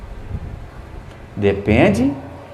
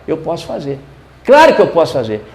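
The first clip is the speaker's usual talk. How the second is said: ordinary talk, nothing marked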